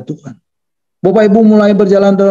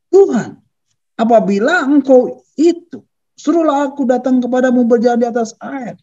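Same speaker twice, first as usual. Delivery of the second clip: calling out; 7 semitones higher than usual. Acted. Peter begs to come to Jesus, calling out. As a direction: call up high to the Lord as Peter. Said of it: "lalu Petrus berseru".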